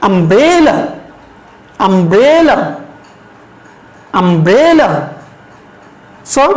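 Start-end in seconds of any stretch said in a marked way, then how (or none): 0.1-4.9 umbrella with the middle syllable stretched and rising; stress on BRE. Shown as a demonstration. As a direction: lean on "bre" in umbrella, then fall away. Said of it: "এখানে আমরা একটু জোর দিয়ে বলবো".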